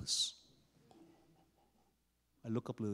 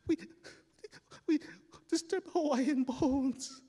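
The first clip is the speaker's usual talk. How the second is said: high, slow, halting wail; blubbering kid, pitched way up; sobbing confession; 13 semitones higher than usual. Acted. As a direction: choke it out between acted sobs. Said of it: "crying in this pool of light".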